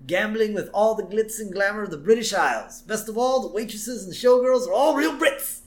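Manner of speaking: high-pitched British showman; British accent. Retold or imitated. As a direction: present it like a proud British impresario. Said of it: "the first is a British man".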